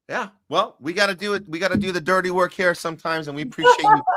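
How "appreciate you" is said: squeaky high gush of thanks that tips into a laugh; gushing squeal. Real, not acted. Host thanking the guest who did dirty work, squealing high.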